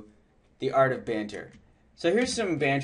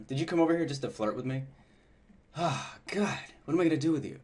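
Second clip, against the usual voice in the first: teasing; 4 dB quieter than usual. Shown as a demonstration. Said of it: "deliver this line like you were ... ribbing somebody".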